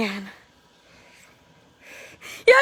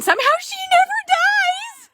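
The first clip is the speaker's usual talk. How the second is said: incredulous; 14 semitones higher than usual. Real, not acted. Exasperated laughing disbelief.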